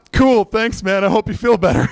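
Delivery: high, cheery retold brush-off; breezy thanks. Visitor collecting his phone from injured driver, breezy.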